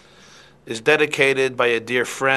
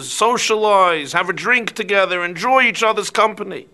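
building from eager to loud, high preaching; fervent fox sermon; 5 semitones higher, 7 dB louder than usual. Acted. Fox preaching redemption to the bird, building to loud fervor. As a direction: sell the bird on redemption, growing louder.

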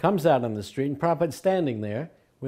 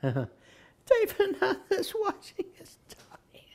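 high, slow whispered repeat; a high breathy whisper, half laughing; slower than usual. Retold or imitated. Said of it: "The devotee kept going".

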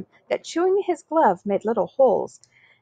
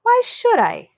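defiant; 11 semitones higher, 4 dB louder, slower than usual. Acted. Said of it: "John wanted to know".

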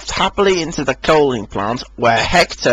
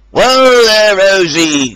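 louder call; 5 semitones higher, 5 dB louder, slower than usual. A narrator's reading. Driver calling Rosie to stop, louder. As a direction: call her to halt. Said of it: "called her driver".

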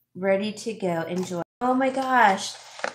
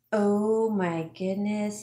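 ordinary talk, nothing marked

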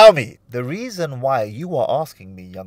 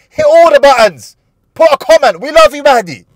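high, loud, excited outro-style shouting; hyped shout; 14 semitones higher, 17 dB louder than usual. Real, not acted. Host hyping viewers to subscribe, shouting high and loud. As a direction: whip the viewers into a frenzy.

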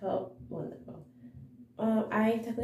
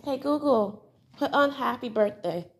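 loud, raised command voice; louder, higher command to a device; calling to voice assistant; 4 semitones higher, 7 dB louder than usual. Real, not acted. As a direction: call out clearly to a smart speaker.

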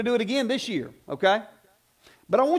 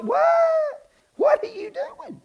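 incredulous shout; 3 dB louder, much slower than usual. Real, not acted. Preacher mocking prayer talk.

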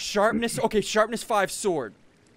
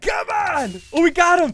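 high, rising to loud triumph; raised, thrilled battle call; excited shout; 9 semitones higher, 5 dB louder than usual. Real, not acted. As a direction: shout as the quarry is cornered. Cornering the hunted target.

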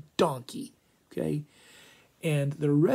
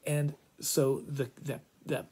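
ordinary delivery

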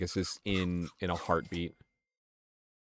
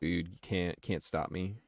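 ordinary talk

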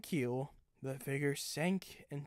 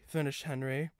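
usual speaking voice

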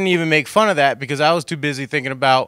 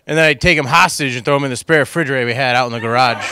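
usual speaking voice

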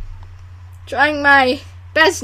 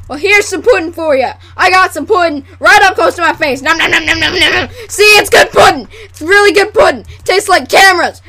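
loud, over-eager pudding salesman; hammed-up commercial pitchman; 3 dB louder than usual. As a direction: play an excitable TV ad host. Acting take two of pudding commercial.

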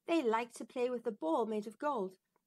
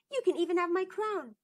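eager princess; 8 semitones higher, 4 dB louder, faster than usual. Acted. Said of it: "said the princess".

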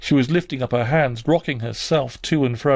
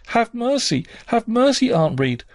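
much higher pitched cry for mercy; distressed plea; 11 semitones higher than usual. A narrator's reading. Child replaying her plea to her aunt, crying out in distress. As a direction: plead in high-voiced distress. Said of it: "though I cried out, while suffocating with distress".

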